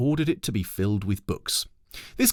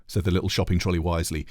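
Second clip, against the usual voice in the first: plain reading voice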